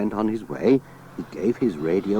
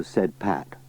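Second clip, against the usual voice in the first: ordinary delivery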